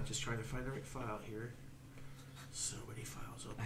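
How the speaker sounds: muttered aside. Real, not acted. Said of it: "GM muttering to self".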